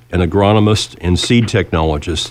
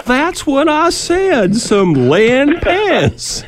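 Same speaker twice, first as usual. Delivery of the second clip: much higher, very slow hammed-up Louisiana drawl; exaggerated Louisiana accent; much slower than usual. [name] insists to the waitress he already ordered it, in a hammed-up Louisiana accent. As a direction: stretch it out in an overdone Louisiana accent. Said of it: "heavily accented way of speech".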